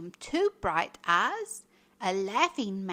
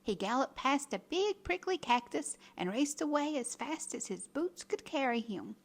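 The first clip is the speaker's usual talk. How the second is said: ordinary delivery, nothing marked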